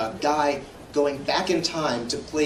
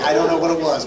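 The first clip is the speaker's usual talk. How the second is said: normal talking voice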